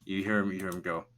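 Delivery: ordinary delivery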